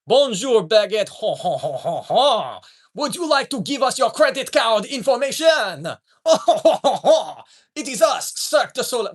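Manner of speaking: high, loud hammed-up Frenchman; mock French scammer with a ho-ho laugh; bad French accent. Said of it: "I can't do a French accent".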